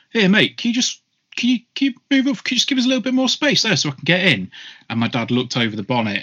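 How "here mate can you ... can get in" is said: much higher, fast, whiny mock voice; high mocking voice of the driver. Stranger asking dad to move up, high fast whine. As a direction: squeak it out quick like a pushy stranger. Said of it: "he said to my dad".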